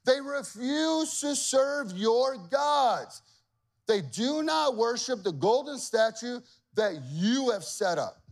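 high, slow whiny accusation; tattling officials. Acted. Officials complaining to the king, whiny. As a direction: whine it to the king like a snitch. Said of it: "these officials are like".

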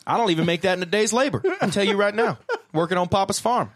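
playful Southern farmhand voice; mock Southern farmhand. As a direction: grumble about farm wages like an old-timer.

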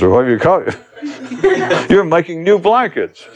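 high, amused delivery with laughter; tickled by his own silliness; laughing. Real, not acted. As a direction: laugh through the words. Amused at his own blanket metaphor.

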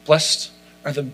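soft, tender blessing read; gentle and kind. Shown as a demonstration. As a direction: speak each blessing gently, like a friend comforting. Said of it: "it would look a little bit more like this".

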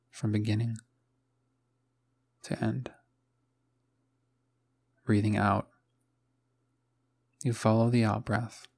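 calm meditation voice with long, restful pauses; guided meditation. Acted. Host guides listeners waking up to follow the breath, calm meditation voice. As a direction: guide them gently, letting each phrase settle into silence.

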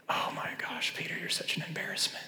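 whispered gossip; mock whisper. Mocking gossip about Peter.

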